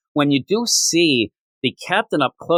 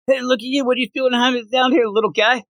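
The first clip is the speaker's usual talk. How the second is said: put-on much higher, cooing at a little kid; father figure teasing a young Zod, high and sing-song; Jor-El voice; 10 semitones higher than usual. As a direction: talk down sweetly to a small boy. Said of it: "Jor-El's like".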